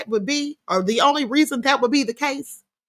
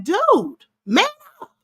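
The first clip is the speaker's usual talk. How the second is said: Judge Joe Brown; 7 semitones higher, slower than usual. Acted. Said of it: "as Judge Joe Brown would say".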